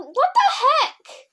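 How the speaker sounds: high, slow drawn-out disbelief; hurt for jumping, high and slow in disbelief; incredulous. Real, not acted. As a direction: stretch out the outrage. Hurt by a jump.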